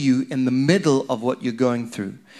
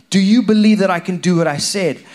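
preacher raising the question with force; louder, pressing repeat; 4 semitones higher, 6 dB louder than usual. Acted. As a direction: Do you believe pushed up and louder.

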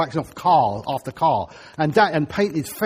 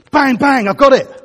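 bang bang, higher and louder with joy; excited; 7 semitones higher, 8 dB louder than usual. Real, not acted. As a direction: burst out as if it just clicked. Preacher excited at revelation.

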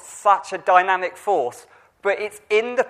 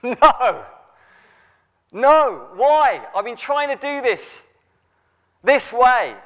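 mock complaint to God; 5 semitones higher, slower than usual. Believer tired of trying, mock exasperated plea to God. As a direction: act out wrestling with God, lightly. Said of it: "where I go".

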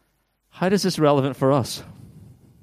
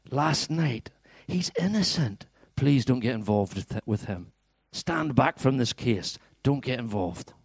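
softly acted wife's plea, drawn out; pleading wife; 5 dB quieter than usual. Wife begs husband Pilate to stay out, soft plea. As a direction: beg softly, hushing to near-whisper in places. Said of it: "His wife sent him this message".

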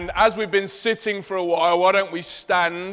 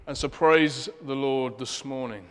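ordinary talk, nothing marked

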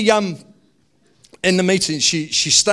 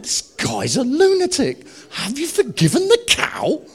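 incredulous; 11 semitones higher, 4 dB louder than usual. Retold or imitated. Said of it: "And I'm like".

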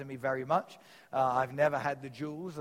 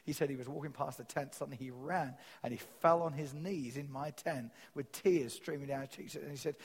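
ordinary delivery

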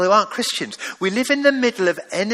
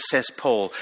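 ordinary talk, nothing marked

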